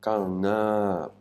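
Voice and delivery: "na" long, high, then falling; demonstrated Thai word. Shown as a demonstration. Teacher showing the finished word, "na" held high then falling. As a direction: hold "na" high and loud, then let it fall. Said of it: "ce qui nous fait le mot".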